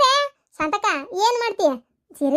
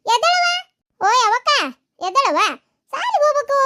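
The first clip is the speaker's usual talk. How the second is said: short, raised wake-up calls; calling to wake; 5 semitones higher, 3 dB louder than usual. Acted. Mother wakes daughter for school, calling out. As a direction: call a sleeping child out of bed.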